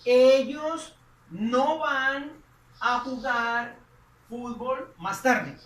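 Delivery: slow, emphatic Spanish gloss; slow translation. Shown as a demonstration. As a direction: say the translation slowly and clearly. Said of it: "Ahí estaríamos diciendo".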